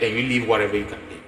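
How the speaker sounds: ordinary delivery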